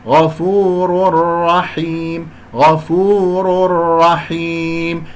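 غفور رحيم merged without nun, slow; slow recitation. Shown as a demonstration. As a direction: recite slowly, no ghunna. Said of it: "لن أقول غفور رحيم كأنه نون".

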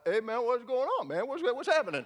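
loud, high, easygoing dude; laid-back guy. Retold or imitated. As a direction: play it cool with the cop. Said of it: "this guy walks out".

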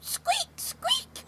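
tiny high whispered squeak; squeaking mice. Acted. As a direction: squeak like a mouse. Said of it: "called four field mice".

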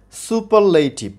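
সুপারলেটিভ slowly, in four parts su-par-lei-tiv; syllable by syllable. Shown as a demonstration. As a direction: break সুপারলেটিভ into slow, even syllables. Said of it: "শব্দটিকে চারবারে উচ্চারণ করতে হয়". (সুপারলেটিভ চার ভাগে ধীরে ধীরে)

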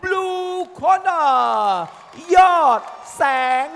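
drawn-out high announcer call; ring announcer. Acted. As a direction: stretch the winner's name like a ring announcer.

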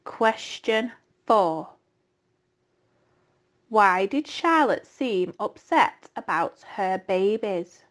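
slow, measured question reading; quiz reading. Acted. Teacher reads the slide questions to pupils, slowly. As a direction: read each question slowly for the class.

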